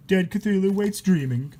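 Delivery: usual speaking voice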